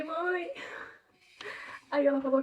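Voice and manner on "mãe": chamado agudo, sem aumentar o volume; desespero encenado. Retold or imitated. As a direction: imite de leve um apelo aflito à mãe. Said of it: "eu desesperada liguei pra minha mãe". (aflição levemente encenada, voz aguda)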